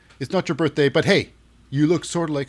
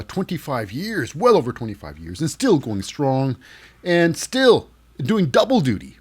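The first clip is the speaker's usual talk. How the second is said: usual speaking voice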